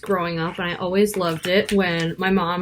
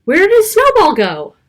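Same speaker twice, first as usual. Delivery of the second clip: higher, louder wondering question; dramatic wonder; 11 semitones higher, 9 dB louder than usual. Acted. Reader asking young listeners about the lost snowball, dramatic. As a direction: ask it with big storytime suspense.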